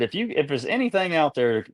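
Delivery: earnest retold plea; retold prayer. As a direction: appeal to the Lord for help. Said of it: "literally prayed to the Lord".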